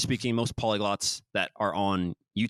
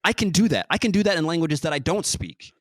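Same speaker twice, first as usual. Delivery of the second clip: emphatic; 7 semitones higher, 6 dB louder than usual. Real, not acted.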